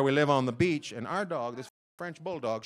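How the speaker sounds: ordinary delivery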